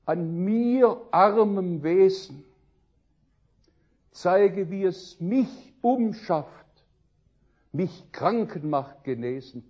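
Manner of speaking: slow, loud, emphatic verse; hymn recitation. Acted. Preacher reciting the schoolmaster's hymn to listeners, fervent declamation. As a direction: declaim it as a fervent prayer, word by word.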